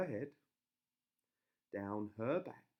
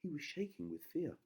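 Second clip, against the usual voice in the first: ordinary delivery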